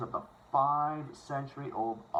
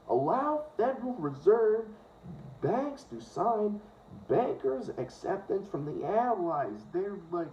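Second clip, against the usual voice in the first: put-on higher-pitched, drawn-out Fargo accent; slow, lilting North Dakota accent; 6 semitones higher, slower than usual. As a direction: stretch the vowels like someone from Fargo. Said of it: "I sound like I'm from fucking Fargo".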